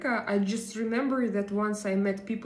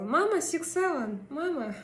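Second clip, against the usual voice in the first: imitating her kids; 7 semitones higher than usual. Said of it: "they go".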